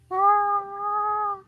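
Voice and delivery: very high, squeaky cry of alarm; a sudden squeal of fright; hammed-up shriek; high startled yelp.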